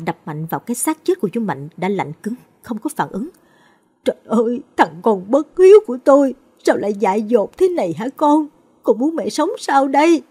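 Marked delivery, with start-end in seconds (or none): 4.0-10.2 high, loud anguished lament; mother cries over her son's body, loud and high; grieving wail. Acted. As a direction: pour out grief to the heavens. Mother laments dead son.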